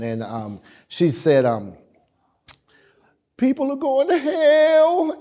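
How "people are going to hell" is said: high, slow, upset woman's plea; distressed lady. Retold or imitated. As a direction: imitate a lady near tears. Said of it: "And she just broke down".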